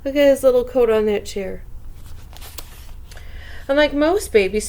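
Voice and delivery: plain reading voice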